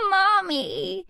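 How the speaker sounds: put-on high little girl crying out with relief; a toddler calling mommy through tears; relieved child. A narrator's reading. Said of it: "she hiccups with relief".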